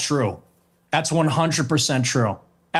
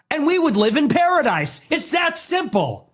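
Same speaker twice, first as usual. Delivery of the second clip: mocking high voice; 10 semitones higher than usual. Real, not acted. Ironic punchline rant.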